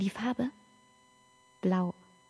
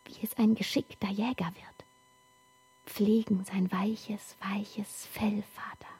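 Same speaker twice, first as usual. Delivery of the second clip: whisper; 6 dB quieter than usual. Acted. Whispered passage about wolves.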